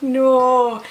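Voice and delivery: loud, high cry of dismay; watching the prescription fall. Retold or imitated. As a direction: wail "no" as the paper floats away. Paper slipping off the desk.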